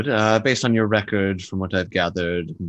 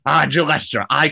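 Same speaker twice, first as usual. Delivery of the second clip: higher and louder, warm hail; hearty greeting; 7 semitones higher, 6 dB louder than usual. Acted. Volo greeting Jelester, hearty. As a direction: clap him on the shoulder with your voice. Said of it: "he says".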